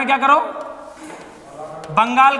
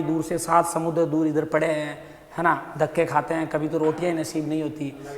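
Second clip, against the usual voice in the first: much lower, softer, fawning trader; humble pleading merchant; 7 semitones lower, 7 dB quieter than usual. Retold or imitated. English trader pleading poverty to the emperor, meek and much lower. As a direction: play poor and modest to win favour.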